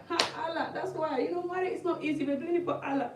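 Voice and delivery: high-voiced, hammed-up pious resignation; mock resigned. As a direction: console her in a high, put-on voice. Acts women resigned to hard marriage.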